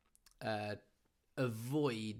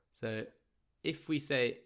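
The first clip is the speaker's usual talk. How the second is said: ordinary talk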